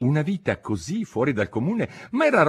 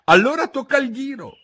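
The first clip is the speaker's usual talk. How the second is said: high, loud cry; excited high shout; 7 dB louder than usual. A narrator's reading. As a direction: yell it out as two impatient characters. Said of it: "urlarono insieme gli altri due".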